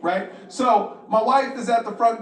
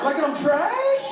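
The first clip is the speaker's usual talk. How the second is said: offended disbelief; 7 semitones higher, much slower than usual. Retold or imitated. Wife sends him outside.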